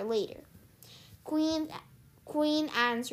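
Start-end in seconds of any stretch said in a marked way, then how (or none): none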